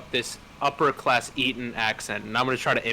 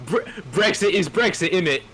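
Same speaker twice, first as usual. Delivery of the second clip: mock British; 5 semitones higher, 4 dB louder than usual. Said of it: "So now you said".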